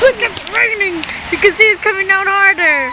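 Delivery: high, loud, delighted; thrilled. Real, not acted. Vlogger shows viewers the rain, excitedly. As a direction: point it out, full of glee.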